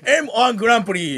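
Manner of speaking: high, hammed-up show title call; mock title announcer. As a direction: announce the contest like a TV opener. Mimics show opening announcer.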